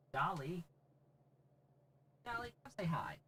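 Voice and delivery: high, soft call to the cat; high cooing call; pet talk. Real, not acted. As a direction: coax the kitty over. Calling cat softly.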